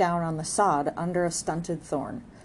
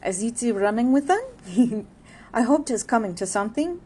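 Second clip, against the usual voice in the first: louder gossiping neighbor, with a laugh; playful neighbor; 5 semitones higher, 5 dB louder than usual. Acted. Neighbor teasing Arabella about Jude, laughing playfully. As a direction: tease her, chuckling. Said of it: "She at once spoke playfully".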